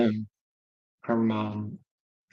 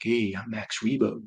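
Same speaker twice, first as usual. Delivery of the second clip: a softer, slower smooth Max Rebo; smooth talker; 4 dB quieter than usual. Retold or imitated. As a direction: ease it out like a lounge smoothie. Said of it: "like, a smooth talker".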